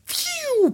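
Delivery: high whistling jet whoosh; airplane takeoff noise. Retold or imitated. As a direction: whistle a plane taking off. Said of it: "je fais très bien l'avion qui décolle". (sifflement aigu d'avion qui décolle)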